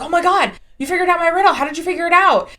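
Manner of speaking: high, loud astonished cartoon character; amazed key man. Retelling the key man's shock at his solved riddle, amazed. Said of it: "the man who's shaped like a key is like".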